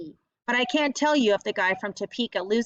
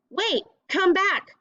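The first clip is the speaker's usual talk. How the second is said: higher, slow mock call; 9 semitones higher, 3 dB louder, slower than usual. Tressa calling after Delcy as she leaves, slow mock call. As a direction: call after her, drawn out. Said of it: "Tressa calls".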